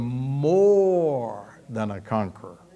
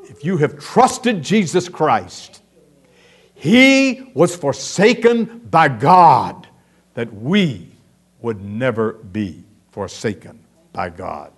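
usual speaking voice

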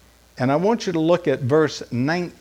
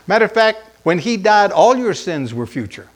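ordinary delivery

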